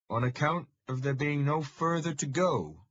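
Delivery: put-on a Fenland farmer's broad local accent, dry and wry; familiar local accent. Roadside man giving directions to Thomas in a local accent. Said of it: "he says in a familiar accent".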